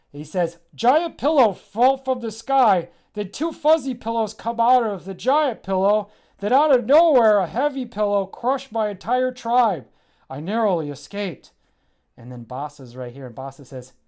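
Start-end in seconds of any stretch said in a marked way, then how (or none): 0.7-11.5 a simple creature babbling its tale; mud pillow creature. Acted. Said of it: "a mud pillow explaining what just happened".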